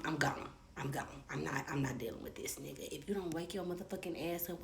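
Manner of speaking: hushed menacing whisper into his ear; soft threatening whisper. Retold or imitated. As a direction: threaten him under your breath. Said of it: "I whispered, softly threatened".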